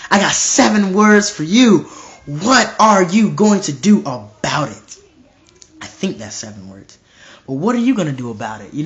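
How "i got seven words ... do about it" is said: slow, loud, punched word by word; emphatic challenge. Real, not acted. Preacher confronting listeners with seven words for them, emphatic challenge. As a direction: drive each word at the listener, drawn out and forceful.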